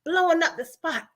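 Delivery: fed-up inner grumble, acted out; imitated fuming. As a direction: fume as him without raising the volume. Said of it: "he fuming right now".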